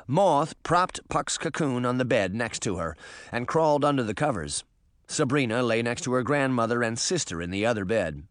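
ordinary delivery

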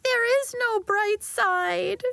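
high, slow, tearful; girl crying on her friend's shoulder. Acted. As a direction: drag it out through sobs. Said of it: "Nori cried and cried".

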